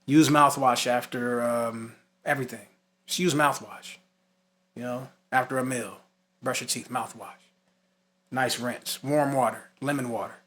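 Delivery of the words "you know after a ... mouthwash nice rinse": usual speaking voice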